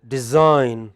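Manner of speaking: DESIGN with a loud, long SIGN; light de-, strong -SIGN in Design; final-syllable stress. Shown as a demonstration. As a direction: punch the second syllable of Design. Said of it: "bring that distinction very clearly".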